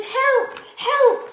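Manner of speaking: high, loud hopeful calling; shouting for help. Acted. Stranded girl waving her shirt, calling loudly to the boat. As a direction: wave and yell to be rescued. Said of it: "and shouted".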